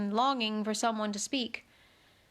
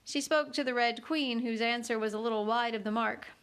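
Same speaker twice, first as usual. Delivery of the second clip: ordinary delivery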